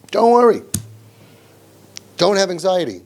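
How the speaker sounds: high, bright dismissive reassurance; mock soothing. Parent mockingly tells anxious kid, high breezy dismissal. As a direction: toss it off like a magic fix. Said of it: "and you say".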